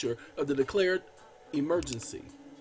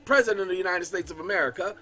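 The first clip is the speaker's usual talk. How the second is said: loud, formal reading of a presidential decree; proclamation read; 3 semitones higher, 4 dB louder than usual. Acted. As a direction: declaim it like an official proclamation. Reads FDR proclamation aloud.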